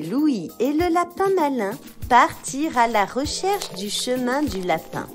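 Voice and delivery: usual speaking voice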